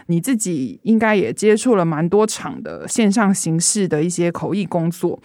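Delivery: ordinary talk, nothing marked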